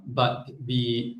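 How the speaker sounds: usual speaking voice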